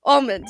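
Almond said loudly as "OL-mun"; deliberately odd pronunciation. Shown as a demonstration. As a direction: round the start of Almond to "ol", dropping the d. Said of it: "I say it so much wrong".

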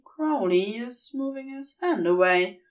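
plain reading voice

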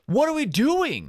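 high exasperated plea; high, baffled what-are-we-doing. Retold or imitated. Exasperated at silent team.

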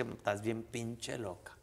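drawn out slow, each word weighed; mock-stern slow verdict; slow fond scolding. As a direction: tease her slowly, lovingly. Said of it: "Yo le dije".

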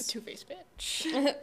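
slow, breathy teasing whisper. Real, not acted. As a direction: hiss it like gossip.

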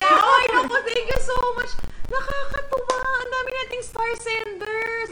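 high, loud delighted thanks; host thanking senders with delight; gushing thanks. Real, not acted. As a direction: beam your gratitude.

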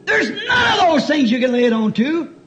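shouted high preaching. Acted. Preaching at full force.

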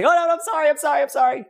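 high, fast panicked apology in a pretend voice; mock frightened pleading. Preacher mocks a fearful Stephen's pleading, voice pitched high. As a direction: play a cowering man begging not to be hurt. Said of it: "did not respond out of fear".

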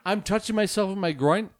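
ordinary delivery

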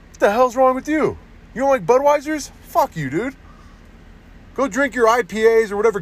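high-pitched indignant rant; mock-outraged beer rant. Real, not acted. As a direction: rant at them, voice climbing high. Ranting at non-Bud drinkers.

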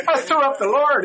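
put-on high, loud boy voice, insisting. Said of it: "He goes".

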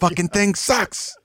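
slow, high mock tantrum; high imitation of an angry presenter; Bill O'Reilly outburst. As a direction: drag out the imitated host's exasperation. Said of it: "what was the Bill O'Reilly one".

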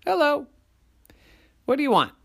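very high little voice; tiny little person. A narrator's reading. Tiny person answering monsters at the door, little high voice.

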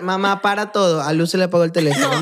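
ordinary talk, nothing marked

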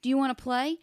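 put-on high, loud kid making a friend; eager children. One child asking another to play, eager. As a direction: pipe up like a keen child.

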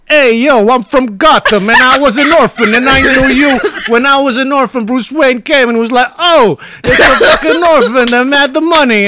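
put-on broad Bronx accent, pushed high. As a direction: do a hammed-up Andrew Dice Clay-style Bronx voice. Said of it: "sounds like they're from the Bronx".

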